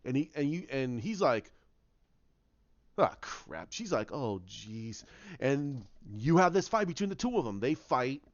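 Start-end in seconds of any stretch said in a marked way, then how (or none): 3.0-3.7 much lower, dry ah crap; resigned groan. Retold or imitated. Kylo faces the knight. 4.1-5.0 much softer, lower oh jeez; Rey muttering softly at the threat; worried aside. Retold or imitated.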